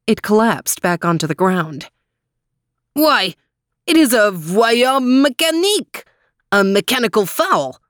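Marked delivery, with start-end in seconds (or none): 2.9-7.8 put-on excited French-accented cry. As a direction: exclaim like a French dog. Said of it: "cried Max".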